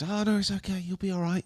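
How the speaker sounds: high, sweet reassurance; soothing mock comfort. Girls comforting him after a bad shot, high and sweet. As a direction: coo it like a kind friend.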